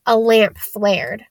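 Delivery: plain reading voice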